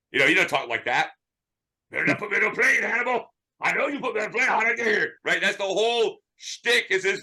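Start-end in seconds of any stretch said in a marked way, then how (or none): 1.9-5.1 higher, lightly voiced tough guy; a hint of Mister T's gruff bark; Mr. T impression. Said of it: "some guy just doing, uh, Mister T".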